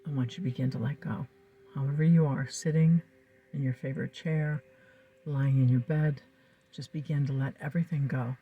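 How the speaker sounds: calm, soothing hypnotic guidance; hypnotist. Acted. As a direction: lull the listener into trance. Leads a hypnosis session over music.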